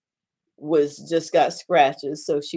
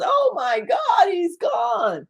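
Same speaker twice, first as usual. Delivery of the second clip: anguished cry; slower than usual. Acted. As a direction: cry out as if hearing it first time. Grieving patient hearing news.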